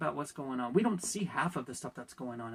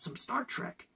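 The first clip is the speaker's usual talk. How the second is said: normal talking voice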